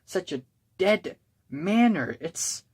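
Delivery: ordinary talk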